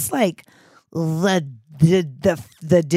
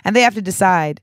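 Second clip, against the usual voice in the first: normal talking voice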